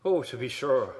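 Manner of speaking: a quick stock Irish accent, on request. Shown as a demonstration. Asked to do an Irish voice, a stock Irish accent. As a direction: play up the Irish lilt for a friend. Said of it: "Can you do an Irish voice?".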